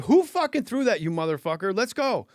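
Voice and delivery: high, loud challenge to the culprit; mad. Retold or imitated. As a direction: re-enact calling out the heckler in anger. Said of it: "You're mad".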